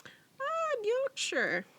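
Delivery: high-pitched, hammed-up British accent; mock British accent. Co-host echoing the place name, mock British accent. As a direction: echo it in a silly, high British voice.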